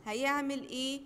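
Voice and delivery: ordinary talk, nothing marked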